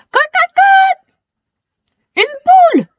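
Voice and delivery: high, loud clucking cry; hen cluck then cry. Acted. Clucking hen sound beside the girl. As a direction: squawk it out like a startled hen. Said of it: "s'exclame-t-elle".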